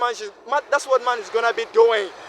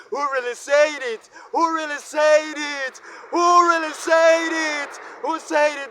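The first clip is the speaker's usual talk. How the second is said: anguished challenge; 4 semitones higher than usual. Real, not acted. Asking who said men cannot cry, anguished and building. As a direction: build from a plea to a high, stretched shout.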